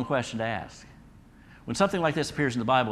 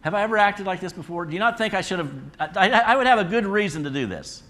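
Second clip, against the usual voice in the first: higher, louder pleading donkey voice; donkey reasoning with its master, higher and louder; 8 semitones higher, 6 dB louder than usual. Acted. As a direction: argue your case like a hurt, faithful animal. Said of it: "now the donkey's reasoning with him".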